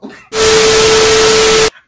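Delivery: big loud airy cackle; loud breathy laugh. Real, not acted. Cracking up at herself to her subscribers, loud breathy laugh.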